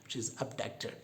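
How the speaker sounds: ordinary talk, nothing marked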